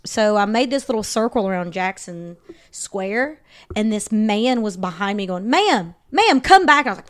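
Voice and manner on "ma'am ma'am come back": high, loud imitated call; calling after someone. Man following her in the square, calling out urgently. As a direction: call out to stop a stranger.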